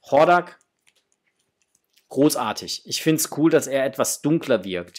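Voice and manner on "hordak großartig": ordinary talk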